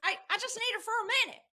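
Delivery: Ross Perot voice. Retold or imitated. Ross Perot asking to speak with Barbara, high Texan twang. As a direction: rattle it off like Perot in a hurry. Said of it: "it's me, Ross Perot".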